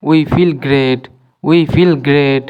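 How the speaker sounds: ordinary talk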